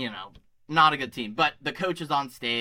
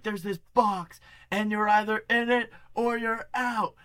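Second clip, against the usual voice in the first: higher, hyped-up pep rally speech voice, lightly imitated; coach rallying kids; 7 semitones higher than usual. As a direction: pump up a packed auditorium like the coach. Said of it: "He's like".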